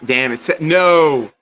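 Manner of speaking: ordinary talk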